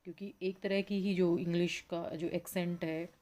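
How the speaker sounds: usual speaking voice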